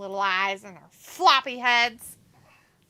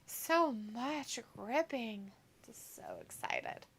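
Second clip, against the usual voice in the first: sarcastic whisper; 13 dB quieter than usual. Pretend. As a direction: whisper it with mock awe.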